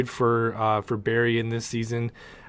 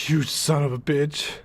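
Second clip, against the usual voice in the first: bitter insult; 3 semitones higher than usual. Retold or imitated. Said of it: "Cisco's argument is".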